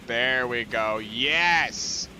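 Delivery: loud happy cheer as the train sets off; excited cheer. Real, not acted. Gamer cheering his train on, excited. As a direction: celebrate out loud.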